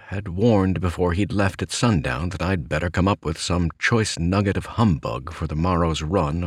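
usual speaking voice

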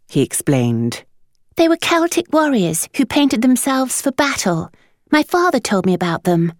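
put-on high boy's voice explaining; an earnest eight-year-old; eager boy. A narrator's reading. Marmaduke explains the Picts.

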